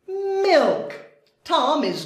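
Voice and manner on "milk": Milk said high and bright; high excited Milk. Shown as a demonstration. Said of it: "spelled then said".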